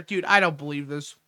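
usual speaking voice

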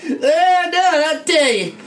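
laughing. Real, not acted. Cracking up mid-scene.

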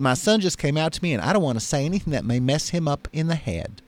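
a hint of an anxious Mississippi mother; Mississippi accent. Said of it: "how's my Mississippi accent".

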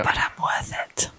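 hushed, fully whispered ad line; whispering a beauty slogan. Acted. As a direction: whisper it like an advert tagline.

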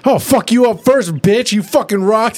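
high, brash mock comeback; Rainbow Dash comeback. Recapping the episode, voicing Rainbow Dash answering the rock. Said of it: "Rainbow Dash is just like".

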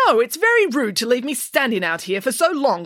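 high, aggrieved doctor; complaining. A narrator's reading. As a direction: whine about being kept waiting. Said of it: "complained the doctor".

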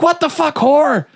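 very high mock-furious exclamation; hammed-up outrage in a high voice; outraged. Father finds his daughter with men, mock outraged.

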